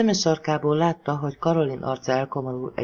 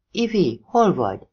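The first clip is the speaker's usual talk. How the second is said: calling out; 4 semitones higher, 4 dB louder than usual. Acted. Calling through the door.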